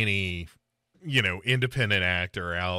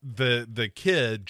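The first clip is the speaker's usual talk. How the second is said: ordinary delivery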